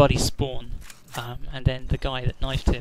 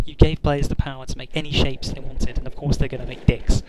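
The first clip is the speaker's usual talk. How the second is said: usual speaking voice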